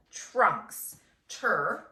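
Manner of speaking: ordinary delivery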